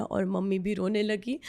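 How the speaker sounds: ordinary delivery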